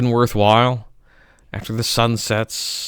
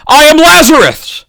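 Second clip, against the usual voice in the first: cried out much higher and louder; proclaiming Lazarus; 12 dB louder than usual. Acted. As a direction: announce yourself risen from the dead. Lazarus quote within poem.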